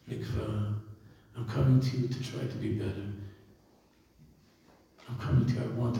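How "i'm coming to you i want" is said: normal talking voice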